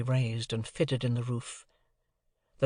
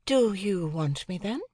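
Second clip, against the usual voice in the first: slow, higher and grave; a hint of cold anger; lightly voiced stern young lady; serious Estella; 6 semitones higher than usual. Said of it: "fixed and serious, if not angry, look".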